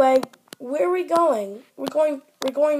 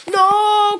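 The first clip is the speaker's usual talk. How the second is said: loud, higher shout of no; cry of no; 6 semitones higher, 6 dB louder than usual. Acted. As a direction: cry out before the crash. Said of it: "cried Smudger".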